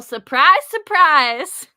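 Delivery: loud, bright reveal of surprise; spring the surprise on the new viewers; excited surprise. Real, not acted. Host springs surprise on raiders, loudly excited.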